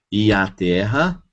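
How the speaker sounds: ordinary talk, nothing marked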